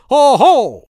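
loud high shout to scare birds. A narrator's reading. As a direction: yell it out to startle the cranes. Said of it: "gridò".

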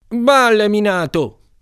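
high, loud, slow scoff; scoffing kid. Acted. Carruba mocking the mine fear, high, loud and slow. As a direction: brush off the warning with scorn.

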